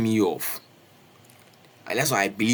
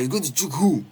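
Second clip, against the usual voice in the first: higher, bolder mock challenge; pretend tough retort; 5 semitones higher than usual. Retelling his bluff at the attacker, pretend tough voice. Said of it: "pretending to be hard".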